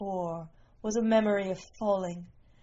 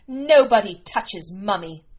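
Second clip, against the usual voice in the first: loud, firm threat to the whole room; defiant and forceful; fierce warning; 8 dB louder than usual. A narrator's reading. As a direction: warn them off forcefully, protecting your mother. Daughter warns room after killing attacker.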